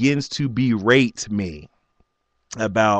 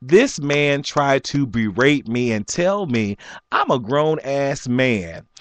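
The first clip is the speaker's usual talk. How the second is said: ordinary talk, nothing marked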